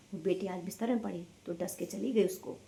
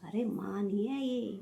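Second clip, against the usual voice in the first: ordinary delivery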